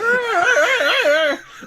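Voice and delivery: high, loud squealing laugh; a laugh that squeals up high; shrieking laugh. Real, not acted. Stuck on the Wookiee name, shrieking laugh.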